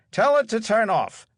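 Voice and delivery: booming theatrical invitation; showman's prompt. Acted. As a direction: coax the kid like a grand performer. Magician coaxing the boy onstage.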